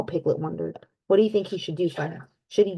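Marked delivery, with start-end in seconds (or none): none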